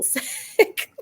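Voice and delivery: ordinary talk, nothing marked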